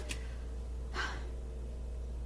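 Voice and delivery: long breathy sigh of relief; relieved sigh. Real, not acted. Relieved pause mid sentence.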